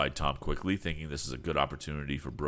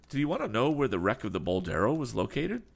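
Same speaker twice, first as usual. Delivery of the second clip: put-on a lightly raised, keen young man's voice; eager young Tom; 7 semitones higher, 5 dB louder than usual. A narrator's reading. Young Tom asks the sea captain about Berg, lightly voiced and eager.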